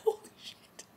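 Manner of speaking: breathless, whispered oh my God; a stunned whisper; shocked whisper. Real, not acted. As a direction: gasp the words out under the breath. Shocked reaction to the killing.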